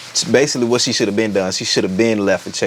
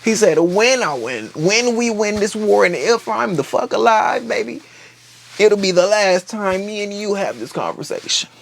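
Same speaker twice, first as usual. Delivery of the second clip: raised, hammed-up parent voice; mock exasperated father; 10 semitones higher than usual. Corlys to his son, a high mock-exasperated threat. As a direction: threaten him with fond exasperation.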